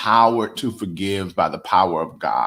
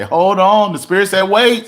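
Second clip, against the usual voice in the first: rising preaching; 9 semitones higher, 6 dB louder than usual. Acted. Preacher building intensity.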